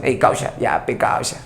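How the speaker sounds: unvoiced teen slang to dad; whispered "ey, causa". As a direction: breathe it like street slang. Son calls father causa.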